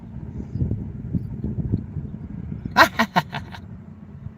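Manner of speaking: soft, breathy laugh at his own joke; quick run of voiceless chuckles; wheezy laugh. Real, not acted.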